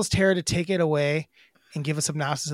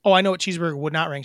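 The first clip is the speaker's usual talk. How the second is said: normal talking voice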